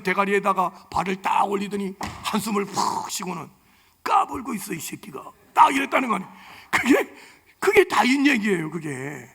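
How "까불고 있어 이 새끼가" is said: fading into a whispered insult; contemptuous sneer. Retold or imitated. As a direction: scorn the dead giant under the breath. David sneers over Goliath. (속삭이듯 비웃는 다윗)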